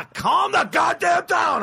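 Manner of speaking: high, drawn-out angry shout; hammed-up furious outburst; getting all mad. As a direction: yell it in exasperation at your girlfriend. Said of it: "I get all mad".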